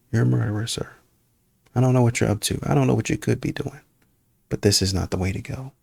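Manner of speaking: ordinary delivery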